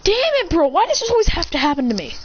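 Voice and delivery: high-pitched, rushed complaint; exasperated whine. Acted. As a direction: blurt it out in fed-up frustration, starting high. Voice heard out of nowhere complains.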